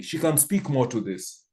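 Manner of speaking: usual speaking voice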